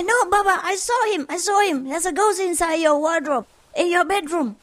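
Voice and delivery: put-on high-pitched little boy protesting; insistent small child. Son insisting to Papa, high child's voice. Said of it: "his five-year-old son".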